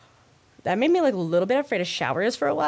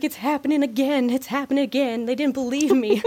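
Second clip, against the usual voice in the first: high, loud, overwrought belle; Southern Belle; 6 semitones higher, 6 dB louder than usual. Retold or imitated. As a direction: lament it like a melodramatic Southern Belle. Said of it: "He sounds like a Southern Belle".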